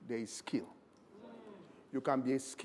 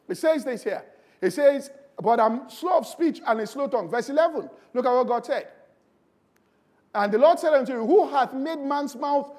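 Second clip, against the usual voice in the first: loud, driving sermon delivery; rising loud pulpit preaching; preaching at full force; 7 semitones higher, 11 dB louder than usual. Acted. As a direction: preach it hard to the congregation.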